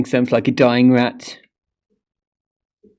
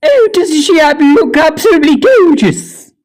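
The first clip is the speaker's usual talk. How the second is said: high, loud, over-the-top gushing; gushing admiration; 9 dB louder than usual. Acted. Vlogger showing off her door to viewers, gushing loudly. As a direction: swoon over it like a beauty queen.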